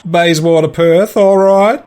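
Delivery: put-on slow Australian accent, rising on "all right"; drawn-out Australian accent on "Bayswater Perth"; Chopper's Australian accent. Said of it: "I literally took that accent from the movie Chopper".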